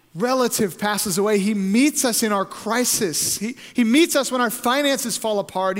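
driving, high preacher's list; impassioned preaching. Real, not acted. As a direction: preach each phrase with building force.

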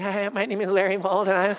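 quavering, nervous self-introduction; mock shaking voice. Nervous evangelist greets a man at the door, hammed-up shaky voice. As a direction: act out trembling through a greeting. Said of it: "you know, I'm shaking".

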